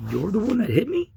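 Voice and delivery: can't believe it's him; incredulous. Retold or imitated. Realizing officer hit him.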